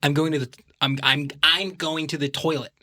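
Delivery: nasal, lifted American impression; American accent. Shown as a demonstration. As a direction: talk through the nose like an American. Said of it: "they have a higher inflection when they talk".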